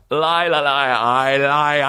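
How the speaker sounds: stuttering king. Retold or imitated. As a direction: stammer through lie, lie like the King's Speech. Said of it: "bad acting".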